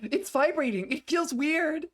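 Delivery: high-pitched mock of a game voice; mimicking a game character. Streamer repeats a line out of context, high mimicry.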